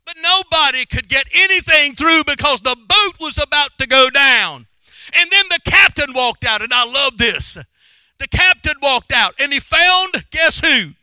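high, loud preached storytelling; fired-up pulpit narration at full voice; full-force preaching. Real, not acted. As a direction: build the Bible story to a shout.

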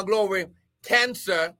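ordinary talk

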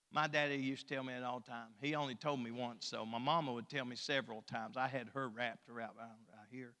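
ordinary talk

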